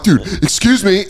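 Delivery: high, strained plea; exasperated plea. Retold or imitated. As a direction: beg them to move. Pushing through packed crowd.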